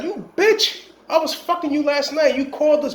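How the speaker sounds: high, mock-angry yell at the woman; hammed-up furious gunman; angry shooter. Gunman yelling at the woman who set them up, high and mock-angry. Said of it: "the guy got angry. He's like".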